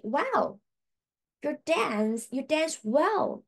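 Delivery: high, slow admiring exclamation; mock praise. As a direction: gush over the dance, drawn out. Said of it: "and then we will say".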